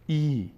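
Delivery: "ای" as a held, pure ee; long i sound. Shown as a demonstration. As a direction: sustain "ای" as one long ee vowel.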